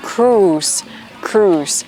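"cruise" as "krooz", long "oo"; correct pronunciation. Shown as a demonstration. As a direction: model "cruise" slowly with อู and a final hiss of s. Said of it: "ตัวนี้ออกเสียงว่า cruise".

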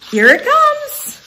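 very high eager bird voice; robin voice. Acted. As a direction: sing it out like a bird. Second robin speaks.

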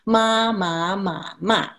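ma in the four Mandarin tones; teacher slowly reciting the four tones on "ma"; tone demonstration. Shown as a demonstration. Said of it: "to pronounce the tones".

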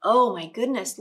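ordinary delivery, nothing marked

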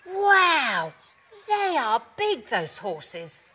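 high, loud storybook amazement; stretched-out wow, full of wonder; awestruck dog character. A narrator's reading. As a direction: exclaim with a big high swoop. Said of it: "barked Alfie".

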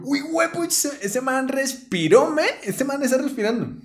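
high, excited astonished exclamations; amazed. Retold or imitated. Narrator amazed the fallen man starts breathing, high excitement. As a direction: burst out in disbelief, pitched high.

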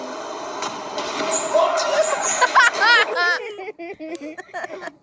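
long high-pitched giggling; high giggle fit. Real, not acted. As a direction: burst into giggles. Laughing at the clip.

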